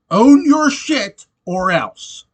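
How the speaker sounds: a worked-up demand, dragged out and loud; riled-up rant. Real, not acted. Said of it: "all riled up today".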